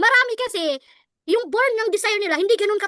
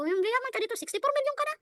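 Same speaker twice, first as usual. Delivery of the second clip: soft, deadpan sales line; flat, lifeless pitch; 6 semitones higher, 7 dB quieter than usual. Shown as a demonstration. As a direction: say it like someone at a funeral. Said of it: "Parang ganun ba?". (walang buhay na alok)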